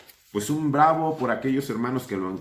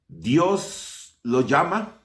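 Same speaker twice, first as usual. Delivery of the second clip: ordinary talk, nothing marked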